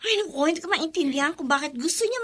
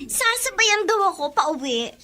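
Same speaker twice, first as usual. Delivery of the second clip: usual speaking voice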